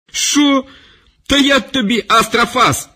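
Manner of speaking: shrieking official loses his temper; outraged shout. Acted. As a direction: explode in fury at the insult.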